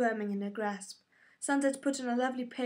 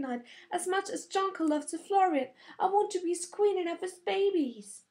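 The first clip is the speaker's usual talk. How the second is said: put-on higher girlish voice, earnest and dreamy; lovestruck girl; 8 semitones higher, 3 dB louder than usual. A narrator's reading. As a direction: gush about the prince you love. Narrator voices Sansa.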